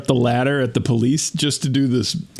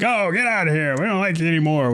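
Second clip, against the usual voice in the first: higher, pushy shooing of a pet; imitating a movie owner sending a pet away, higher; mock rejection; 6 semitones higher than usual. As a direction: pretend to drive the dog off. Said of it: "You're like".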